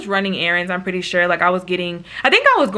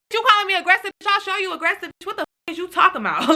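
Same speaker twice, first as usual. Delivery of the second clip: mock angry comeback, pitched way up; mad; 10 semitones higher, faster than usual. Retelling the phone fight, she snaps back in a high mock-angry voice. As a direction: fire it back like she just got insulted. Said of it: "And I started getting mad".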